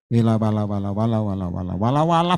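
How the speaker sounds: low mocking babble; mock tongues. Pastor parodying speaking in tongues, low mock babble.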